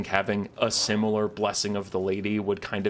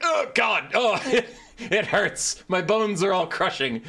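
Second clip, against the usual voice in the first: high, loud, pained cries; crushed victim; 11 semitones higher, 3 dB louder, slower than usual. Retold or imitated. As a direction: whimper like bones are breaking. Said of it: "and you're like".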